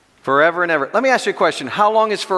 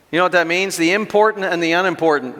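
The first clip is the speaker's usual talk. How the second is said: usual speaking voice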